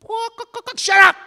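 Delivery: high mock clucking taunt; chicken clucking. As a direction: cluck like a chicken, then snap. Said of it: "You're the chicken man".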